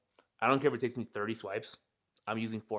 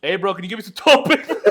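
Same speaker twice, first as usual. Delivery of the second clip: louder, laughing through the plea; laughing request; 7 semitones higher, 9 dB louder than usual. Retold or imitated. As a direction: ask for paper while cracking up. Asking stranger for toilet paper.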